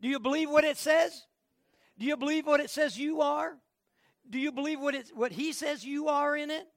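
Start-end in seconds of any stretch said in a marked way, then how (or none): none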